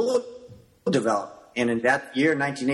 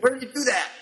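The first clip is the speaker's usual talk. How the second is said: eager question; 11 semitones higher, faster than usual. Retold or imitated. Eagerly questioning the Taekwondo guy.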